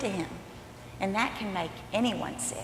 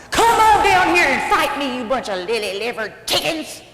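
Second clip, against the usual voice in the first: big taunting shout; yelling giant; 4 semitones higher, 9 dB louder than usual. Acted. Giant challenges the Israelite army to fight, yelling. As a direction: yell the challenge across a battlefield. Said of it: "yells at the Israelites".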